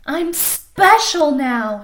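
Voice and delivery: slow, drawn-out proud declaration; cried out proudly. Acted. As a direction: savor each word like a new star. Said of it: "she cried out loud".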